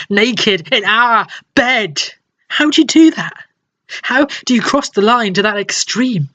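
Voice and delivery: high-pitched, hurt outrage; acted anguish, pitch leaping up; outraged disbelief. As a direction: cry it out in disbelief at the betrayal. Outraged at finding partner in bed.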